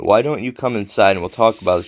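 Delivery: ordinary delivery, nothing marked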